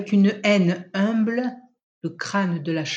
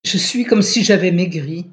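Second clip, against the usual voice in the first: raised, louder voice; a young wife saying aloud, noticeably louder; 8 dB louder than usual. A narrator's reading. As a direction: speak it out loud to herself. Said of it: "dit-elle à haute voix".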